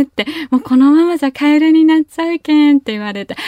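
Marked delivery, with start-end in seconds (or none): 0.5-2.8 higher, louder hurried warning; urgent mother. Retold or imitated. Mother urging daughter to return tadpoles, anxious. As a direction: press her to act before it's too late.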